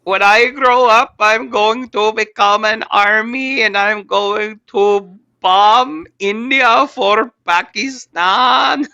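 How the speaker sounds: put-on high, slow kid voice with Pakistani accent; sing-song child with a Pakistani accent, slowly. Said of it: "that fucking Pakistani kid talking".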